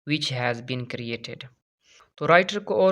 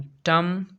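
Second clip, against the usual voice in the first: "टम" punched higher and louder as one stressed beat; stressed syllable demo; 4 semitones higher, 5 dB louder than usual. Shown as a demonstration. Teacher demonstrating dactyl stress to students, "टम" hit higher and louder. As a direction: hit "टम" hard to show the stress. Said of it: "stress है".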